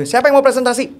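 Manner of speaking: teacher seeking a volunteer; teacher asking the class. Acted. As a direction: call out a question to the class. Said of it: "kalau ditanya sama guru".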